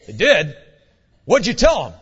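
loud, abrupt questioning; brusque, punchy interrogation; get-down-to-business drill sergeant. Retold or imitated. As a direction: imitate a sergeant demanding a report. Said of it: "let's get down to business kind of attitude".

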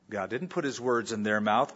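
ordinary delivery

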